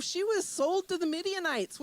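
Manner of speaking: ordinary talk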